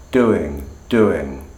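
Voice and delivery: doing said with a clear fall. Shown as a demonstration. Teacher isolating the falling word. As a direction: drop the pitch through doing. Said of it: "that's a falling tone".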